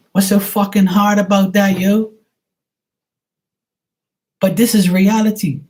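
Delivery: loud, high exasperated outburst; exasperated rant. Real, not acted. Ranting at the audience about calling instead of texting, loud exasperation. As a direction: raise pitch and volume in comic outrage.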